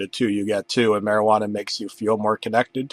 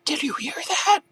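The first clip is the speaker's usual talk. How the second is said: much higher, startled voice; 4 dB quieter than usual. Acted. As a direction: squeak it out in alarm. Startled character question.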